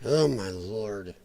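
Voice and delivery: slow, low and soft groan of disbelief; exasperated. Real, not acted. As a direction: drag it out in dismay. Reacting to what he sees.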